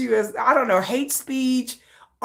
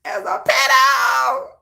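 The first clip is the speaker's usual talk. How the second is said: gleeful mock shout; 8 semitones higher than usual. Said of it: "I'm like".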